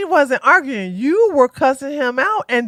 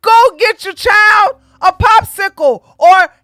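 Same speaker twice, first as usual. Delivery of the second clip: fed-up shout; 4 semitones higher, 3 dB louder than usual. Real, not acted. Ranting about kids in videos.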